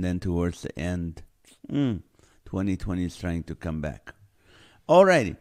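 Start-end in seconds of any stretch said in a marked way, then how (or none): 4.9-5.4 excited host call. Real, not acted. Host restarts the topic.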